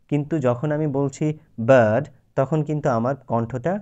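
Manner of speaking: ordinary talk, nothing marked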